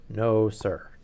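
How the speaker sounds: booming Duke. Acted. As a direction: let the Duke's words boom out like a dinner gong. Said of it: "I was already using my deep voice".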